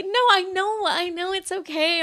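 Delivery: high, wailed reassurance; hammed-up crying insistence; mock bawling. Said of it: "I start bawling again".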